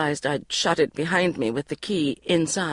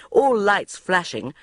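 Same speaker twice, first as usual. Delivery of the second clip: ordinary delivery, nothing marked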